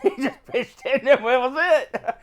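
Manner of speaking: talking through laughter; laughing. Real, not acted. Telling co-host how the guy pitched the card, laughing. Laughing at own story's punchline.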